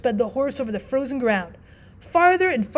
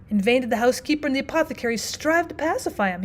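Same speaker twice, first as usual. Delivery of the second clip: ordinary delivery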